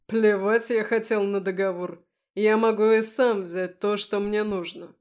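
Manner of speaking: loud, contemptuous demon voice; arrogant demon. A narrator's reading. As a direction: dismiss the deal with contempt. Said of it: "заявил он". (пренебрежительный голос демона)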